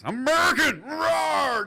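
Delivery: very high, loud shouted roar; mock patriot roar. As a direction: bellow like a chest-thumping patriot. Mocking patriotic American roar.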